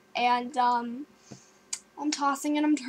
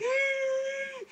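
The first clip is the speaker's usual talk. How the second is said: high, thin, held squeal; acted-out squeal of fright from under the covers; terrified squeal; 11 semitones higher, 4 dB quieter than usual. Storyteller with covers pulled up to the eyes, terrified squeal. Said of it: "I, I, I was terrified".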